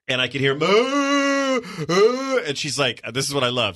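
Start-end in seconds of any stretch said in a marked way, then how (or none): 0.6-2.4 high, drawn-out mock wail; imitated child crying. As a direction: wail like a crying little boy. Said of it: "my younger son Jack starts crying".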